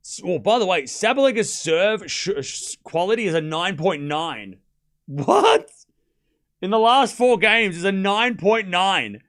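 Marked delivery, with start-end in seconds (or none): 5.1-5.7 shoots way up in disbelief; a startled squeak of disbelief; high shocked what. Real, not acted. Reacting to the serve rating with a shocked squeak.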